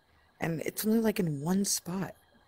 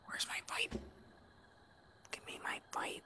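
hushed, whispered plea for a lost item; whispered demand; 16 dB quieter than usual. Real, not acted. Player to game object after losing the pipe, whispering. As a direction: whisper it, soft and voiceless.

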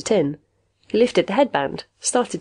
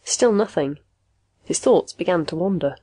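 usual speaking voice

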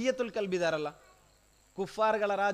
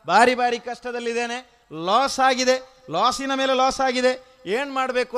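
raised, louder imitation of a complainant; troubled man's plea; 6 semitones higher, 7 dB louder than usual. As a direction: act out pleading for help over hardship. Voices troubled man's plea to Prophet. (ಕಷ್ಟ ತೋಡಿಕೊಳ್ಳುವವನ ಏರಿದ ಧ್ವನಿ)

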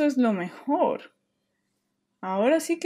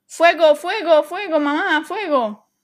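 high, loud alarm shout; yelling fire; 5 semitones higher, 8 dB louder than usual. Acted. Julian yelling fire to wake his sleeping mom, loud. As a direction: raise the alarm to wake her.

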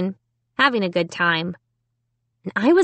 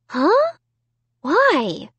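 high, questioning demand; puzzled, high-voiced challenge; 9 semitones higher than usual. A narrator's reading. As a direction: demand a reason, pitch jumping up. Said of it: "Margot demanded".

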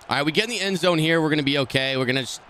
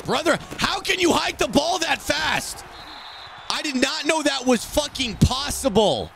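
high, slow, drawn-out holler; incredulous high-pitched rant; exasperated yell; 12 semitones higher than usual. Real, not acted. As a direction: yell each word in outraged disbelief. Exasperated gamer yells at opponent.

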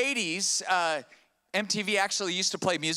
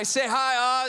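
calling out; 5 semitones higher, 5 dB louder than usual. Real, not acted. Calling the congregation to greet.

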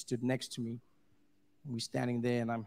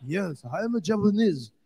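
put-on Japanese accent; 5 semitones higher, 7 dB louder than usual. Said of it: "He's like".

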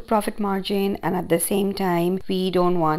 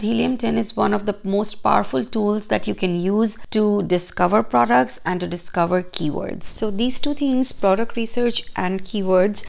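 ordinary talk, nothing marked

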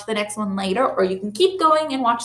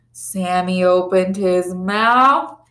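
plain reading voice

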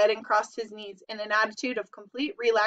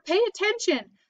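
high-pitched insistent cry; mild scream; 10 semitones higher than usual. A narrator's reading. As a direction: urgently demand attention without full volume. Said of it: "screamed Wilbur".

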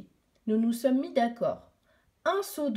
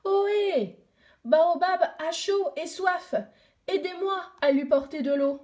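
calling out; 6 semitones higher than usual. Acted. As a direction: hail the village children. Girl calls the children. (appel fort et aigu aux enfants)

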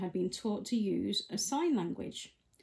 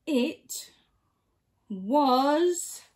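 slow, building, louder confession; a small creature owning up, louder; kitten's confession; 5 semitones higher, 10 dB louder than usual. A narrator's reading.

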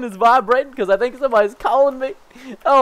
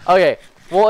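ordinary talk, nothing marked

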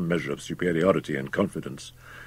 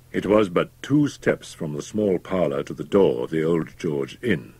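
plain reading voice

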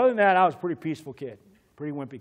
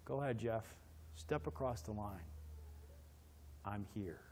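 soft, lower reassuring voice; a buddy calmly saying I'm here; low, calm backup; 6 semitones lower, 19 dB quieter than usual. Retold or imitated. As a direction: quietly back your friend up. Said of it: "Bill goes".

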